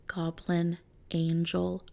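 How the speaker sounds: ordinary talk, nothing marked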